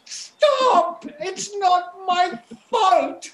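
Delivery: terrified crying. Acted. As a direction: beg through panicked tears.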